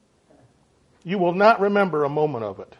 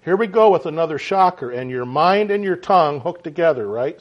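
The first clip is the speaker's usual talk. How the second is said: ordinary talk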